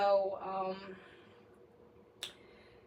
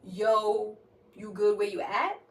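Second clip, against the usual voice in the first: louder, mocking echo of his question; mocking incredulous repeat; 6 dB louder, slower than usual. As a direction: throw his question back, laughing it off as absurd. You asking me.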